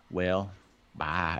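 a drawled Texan "Well, bye", with a long pause before the dismissive bye; Texan twang. Shown as a demonstration. Podcast host shows co-host the movie line, Texan twang. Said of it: "a little more pause in that".

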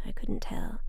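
ordinary delivery

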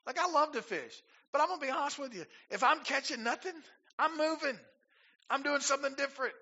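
high, animated aside; lively aside. Real, not acted. Preacher sharing his fishing habit, high and animated. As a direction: get excited about fishing. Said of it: "own fishing confession".

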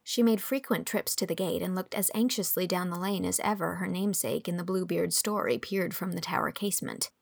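usual speaking voice

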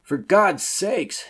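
high, loud, drawn-out yell; screamed warning. Acted. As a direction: scream across the water to warn them off. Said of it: "screamed to the party in the boat".

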